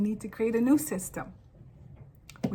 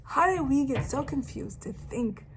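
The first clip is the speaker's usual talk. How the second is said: ordinary delivery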